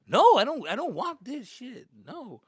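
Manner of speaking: high-pitched refusal, loud at first; mock tantrum, trailing to a sulk; stubborn son. Acted son refusing food.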